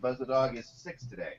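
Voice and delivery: talking to the dog. Real, not acted. Greeting the family dog.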